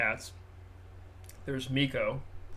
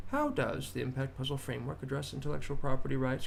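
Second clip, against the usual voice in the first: ordinary delivery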